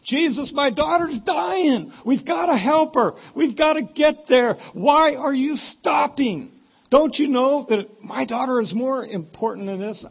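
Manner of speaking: high, loud, frantic pleading; acted panic at full volume; desperate father. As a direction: shout it as an urgent, anguished plea. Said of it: "what's going on in the mind of Jairus".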